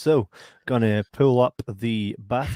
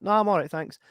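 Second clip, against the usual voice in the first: higher, lightly acted polite decline; polite refusal; 6 semitones higher than usual. Shopper answers someone offering help in a shop, polite refusal. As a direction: turn them down politely, pitched up at normal volume. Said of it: "And you're like".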